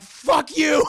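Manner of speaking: high, loud furious outburst; shouted defiance. Retold or imitated. Solomon shouting back at the overseer in fury. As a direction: explode back at him.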